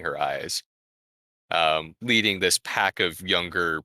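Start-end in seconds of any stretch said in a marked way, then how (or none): none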